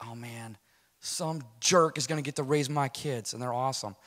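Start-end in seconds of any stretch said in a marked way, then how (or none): 0.1-0.6 very soft, low sigh of resignation; hushed and downcast; quiet dismay. Retold or imitated. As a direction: let it sink out, defeated. Retold dismay at losing his kids.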